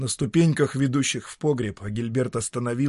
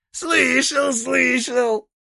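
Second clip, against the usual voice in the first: high, creaky devil voice; a creaky, mocking old devil; 13 semitones higher, 6 dB louder, much slower than usual. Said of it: "донёсся скрипучий голос".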